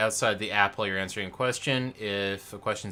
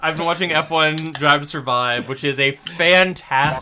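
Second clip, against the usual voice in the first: talking over someone; 5 semitones higher, 9 dB louder than usual. Real, not acted.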